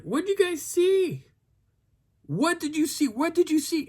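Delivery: very high, stretched-out pleading question; insistent questioning. Retold or imitated. Hiker calling after fleeing couple, high-pitched insistence. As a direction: press them for an answer in a high voice.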